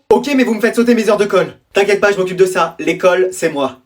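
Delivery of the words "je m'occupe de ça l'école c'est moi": ordinary talk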